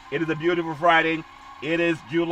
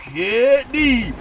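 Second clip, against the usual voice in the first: announcer call; 7 semitones higher, 6 dB louder than usual. Pretend. Call version of "hit deep.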